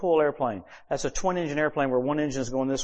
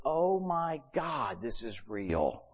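retold slow awe; 6 dB quieter, much slower than usual. Wife's awed reaction, retold.

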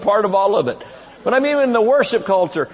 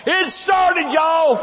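mock shout; 9 semitones higher, much slower than usual. Preacher mocking wrong quote, shouting.

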